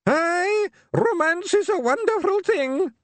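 put-on high creaky old man, loud; deaf old man. A narrator's reading. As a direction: shout it like a half-deaf old geezer. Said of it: "said Mad Hamish".

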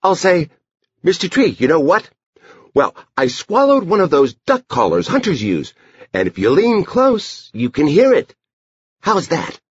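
put-on high-pitched child rehearsing a trick; Edie. A narrator's reading. Girl tries out her duck caller story on brother, high voice.

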